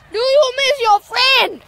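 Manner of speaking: loud, slow, high pet talk. Real, not acted. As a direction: coo at the little guinea. Talking to the guinea.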